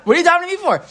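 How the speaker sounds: exasperated God. Retold or imitated. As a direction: push it up like a fed-up God. God rebuking Moshe.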